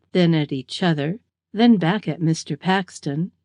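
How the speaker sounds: plain reading voice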